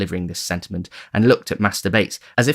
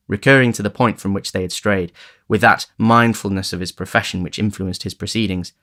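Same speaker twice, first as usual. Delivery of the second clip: plain reading voice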